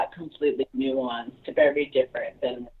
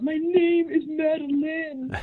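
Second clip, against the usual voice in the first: slow singsong higher mock kid; 13 semitones higher, slower than usual. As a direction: drag it out like a taunting kid. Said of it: "It's like".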